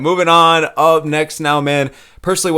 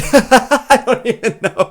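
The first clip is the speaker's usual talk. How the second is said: laughing; 9 semitones higher, 3 dB louder, much slower than usual. Real, not acted. Laughs at his own impression.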